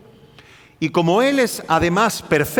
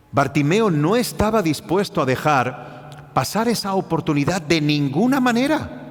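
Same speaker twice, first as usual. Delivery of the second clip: usual speaking voice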